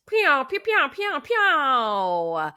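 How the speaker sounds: high-pitched playful pew-pew fanfare; celebratory pew noises. Pretend. Host cheers the bingo winner, playful high pew-pew noises. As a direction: fire off high pews, the last one long and falling.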